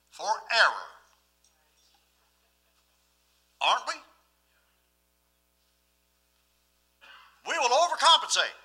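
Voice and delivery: loud, high, fervent preaching; full-force preaching. Acted.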